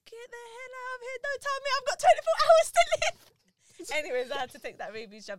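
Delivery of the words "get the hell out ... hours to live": hammed-up outrage. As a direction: act out a disbelieving outburst, slowing into a wail. Acting outraged reply to doctor.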